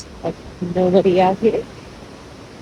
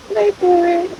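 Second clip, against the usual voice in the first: wait for it, pitched way up; high teasing suspense; 11 semitones higher, 6 dB louder than usual. Real, not acted. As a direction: tease the reveal high and loud. Building suspense at waterfall.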